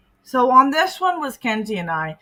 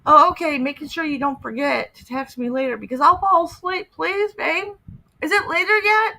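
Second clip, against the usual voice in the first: mock whiny predator; 8 semitones higher, 4 dB louder than usual. Mocking the predator's pleading texts.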